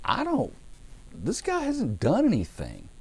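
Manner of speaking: high-pitched mock bewilderment; baffled protest. Preacher voicing Pilate unsure about the prisoner, high baffled protest. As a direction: protest in a high, drawn-out voice of disbelief.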